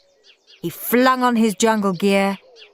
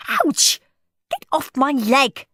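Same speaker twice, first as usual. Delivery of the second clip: put-on pained girl; 7 semitones higher than usual. A narrator's reading. Margaret sat on.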